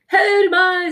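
very high, loud scream; desperate prisoner screaming to be heard. A narrator's reading. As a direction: scream it for attention. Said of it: "skrek Tyttebær".

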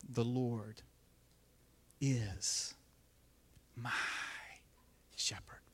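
very slow, hushed, long pauses; slow reverent reading. Shown as a demonstration. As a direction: savour each word, fading to a whisper. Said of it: "Read them slowly".

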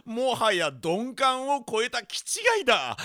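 ordinary talk, nothing marked

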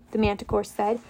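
plain reading voice

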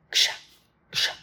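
short, noisy "ksha" cutting sounds; imitated guillotine chops. Storyteller shows himself cutting metal sheets, crisp chopping noises. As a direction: slice with the voice, one chop each.

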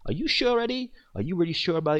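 high, needling mock innocence; mock teasing. Teasing Eddie who insists his birthday passed, high mock doubt. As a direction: poke at him with fake doubt.

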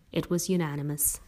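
ordinary delivery

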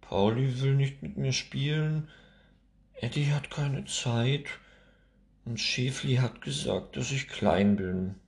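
put-on high, tearful little girl's complaint, lightly sobbed; mock sobbing. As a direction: whimper it out like a hurt child. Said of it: "schluchzt Nora".

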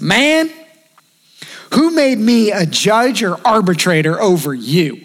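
drawn-out stern question; Jesus rebuking. Retold or imitated. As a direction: stretch out the question like a firm teacher. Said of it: "He says".

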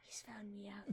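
whispered gasp of alarm. Acted. Villain exposed, aside.

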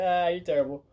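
loud, high retold heckle; mock jeer. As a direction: playfully heckle a failed trick. Repeats the jeer, louder.

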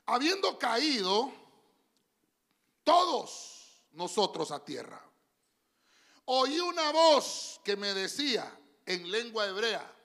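slow, loud, stretched Bible reading; dramatic scripture reading. Acted. Preacher reading Acts to the congregation, slow and solemn. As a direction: read the verse slowly with full weight.

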